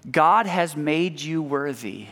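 normal talking voice

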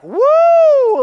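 loud, high joyful whoop; lottery win whoop. Retold or imitated. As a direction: whoop like you just won millions. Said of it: "what would your reaction be? It'd be like".

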